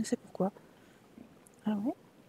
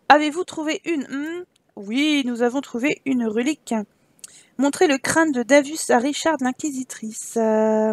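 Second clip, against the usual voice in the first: usual speaking voice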